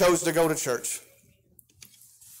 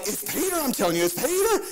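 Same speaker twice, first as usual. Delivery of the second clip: insistent; 7 semitones higher than usual. Retold or imitated. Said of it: "she's like".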